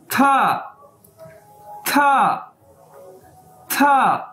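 drilled था, high and falling; Chinese word pronounced. Shown as a demonstration. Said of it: "pronunciation देख सकते हैं". (था को ऊँचे सुर से गिराते हुए बार-बार बोलें)

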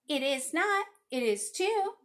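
high, loud back-and-forth; acted playground argument; childish bickering. A narrator's reading. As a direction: contradict like squabbling children. Children trade contradictions.

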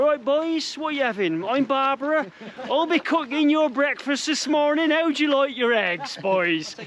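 high-pitched cafe lady in a put-on local accent; Barbara. Cafe owner greeting the boys for breakfast, high mock local accent. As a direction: play a chirpy regional-accent cafe hostess, pitched high. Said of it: "I don't know if you talk like this".

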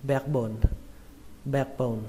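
"backbone" with a spread A, as in "bekbone"; backbone with flat A. Shown as a demonstration. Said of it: "các bạn có thể đọc là âm A bẹt". (đọc "backbone" với âm A bẹt)

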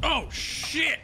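sudden high yelp of surprise; real gamer shock; startled curse. Real, not acted. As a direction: caught off guard, blurt it out high. Sudden shock mid-game.

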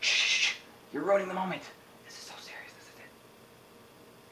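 imitating a moviegoer's urgent shush, sinking into whisper; hushed shushing. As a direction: shush the intruder, then whisper it, rapt. Shushing someone during a film climax.

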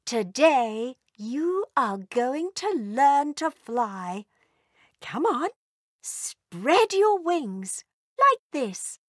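mother dragon teaching her babies to fly, slow and encouraging. Acted. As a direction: coax the babies to spread their wings. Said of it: "Mummy Dragon said".

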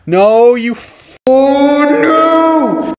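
high, loud anguished outcry; panicked yelling. Real, not acted. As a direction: yell in disbelief. Panic at a friend's play.

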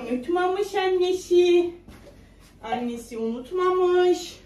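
slow singsong with stretched endings. Real, not acted. Mother telling baby the bottle is here, singsong. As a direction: coo it reassuringly, drawing out words.

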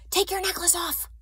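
high, pressing stage whisper; urgent whisper. A narrator's reading. Anne-Marie warning her friend before soldiers enter, urgently. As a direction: hiss an urgent command. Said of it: "she whispered urgently".

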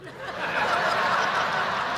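long breathy wheeze of laughter; wheezing laugh. Real, not acted. Laughs at his own story.

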